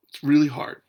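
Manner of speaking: ordinary talk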